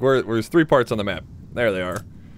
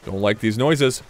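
ordinary delivery

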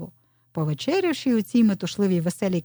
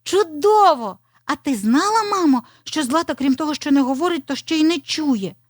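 put-on high, enthusiastic child character; high-voiced excited young fox; eager little fox; 7 semitones higher, 4 dB louder than usual. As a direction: share news with mum, pitched well up. Little fox telling mother.

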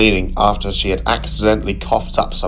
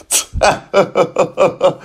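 high mocking laughter; rude cackle; 10 semitones higher than usual. Philip laughing rudely at Gladys, high cackle. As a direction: laugh at her.

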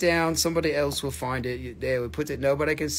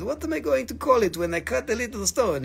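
higher, mock-French accent; badly faked French accent, pitched up for a joke; a puzzled Frenchman, hammed up; French guy in joke; 5 semitones higher than usual. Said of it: "the French guy was".

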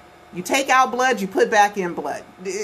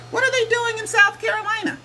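much higher, disbelieving repeat of the question; incredulous squeak; 12 semitones higher than usual. Real, not acted.

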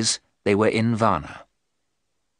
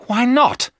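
sharp, high, loud challenge; angry cry; 7 dB louder than usual. Acted. Jonathan objects to keeping plans from Mina, crying angrily. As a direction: fire back in loud indignation. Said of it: "Jonathan cried angrily".

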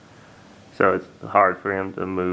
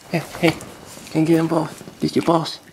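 much higher, bright pet voice; high dog-talk voice; 9 semitones higher, faster than usual. Real, not acted. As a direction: call the puppy to play. Calling to the young dog.

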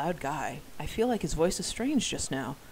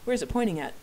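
plain reading voice

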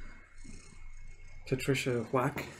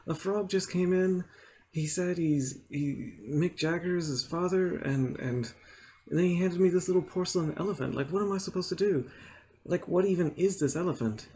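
flustered fast; 6 semitones higher than usual. Acted. Teller panicking to the manager.

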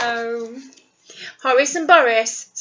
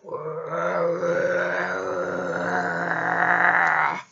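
dramatic gasp; 8 semitones lower, 5 dB quieter than usual. Acted. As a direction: gasp in shock. Gasp at growling in story.

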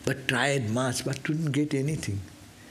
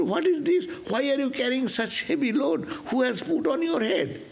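shocked wife; 10 semitones higher than usual. Retold or imitated. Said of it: "But she said".

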